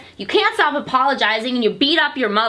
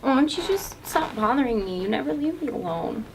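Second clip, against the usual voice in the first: tearful; 6 dB quieter than usual. Acted. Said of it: "Now you're crying".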